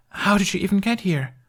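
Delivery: put-on high incredulous boy; amazed kid. A narrator's reading. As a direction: ask in disbelief. Jamie amazed.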